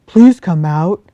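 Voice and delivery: loud, gruff big-bear voice; a big bear sending the fox away, loud and grumpy. Acted. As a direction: shoo her off like a cross old bear. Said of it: "said Jefferson Bear".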